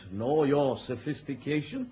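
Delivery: normal talking voice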